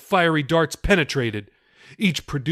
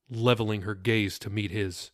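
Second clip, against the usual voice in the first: ordinary delivery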